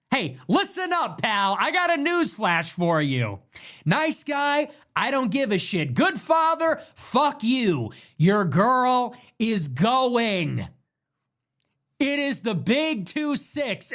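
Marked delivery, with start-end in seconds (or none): 0.1-7.9 mock taunting. Taunting the boyfriend that nice guys get nothing. 8.2-10.7 very slow, loud emphatic declaration. Acted. Hammering home to the boyfriend that his girl leaves.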